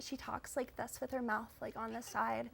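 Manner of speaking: hushed celebrity impression; softly, talking out of the side of the mouth; Katie Holmes. Shown as a demonstration. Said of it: "I can do a really good Katie Holmes impression".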